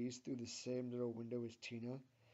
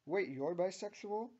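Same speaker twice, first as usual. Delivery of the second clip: higher, louder startled question; curious, shocked; 8 semitones higher, 9 dB louder than usual. A narrator's reading. Tina learns her friend is bisexual, shocked. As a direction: ask it in rising surprise. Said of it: "asks Tina in a curious, like, shocked manner".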